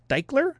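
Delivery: "Diekler" with an EYE vowel and hard K, rising; second guessed pronunciation. Shown as a demonstration. Host guessing at a patron's surname, questioning "Diekler" as DIKE-ler. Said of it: "I don't know".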